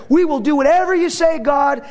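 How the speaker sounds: high, loud vow; declaring loudly. Retold or imitated. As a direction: call it out like a crowd swearing. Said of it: "they declared".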